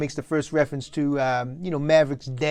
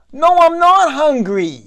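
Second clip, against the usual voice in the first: calling back; 10 semitones higher, 10 dB louder, slower than usual. Acted. Son calls back to mother.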